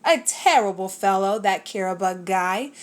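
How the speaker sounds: plain reading voice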